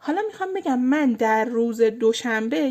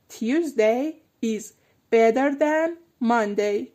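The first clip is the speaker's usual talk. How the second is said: Tuesday is better than Monday read very slowly; slow drill read; much slower than usual. Shown as a demonstration. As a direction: spell it out slowly for students. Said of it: "slow model sentence for drill". (جملهٔ آهسته و شمرده برای تمرین)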